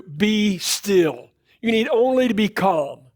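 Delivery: steady the frightened crowd with confidence; brave reassurance. Acted. Moses reassuring the people before the sea, confident. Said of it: "stands up so bravely and says".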